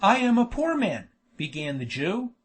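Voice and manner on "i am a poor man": higher, plaintive protest; pleading. A narrator's reading. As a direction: plead poverty in a raised pitch. Miser pleading for his money.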